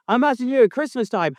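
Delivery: normal talking voice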